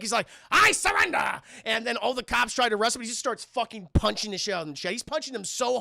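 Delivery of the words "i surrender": high, loud, manic surrender; Joker-esque cry. Retold or imitated. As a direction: shout it up high like an unhinged Joker. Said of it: "very Joker-esque take".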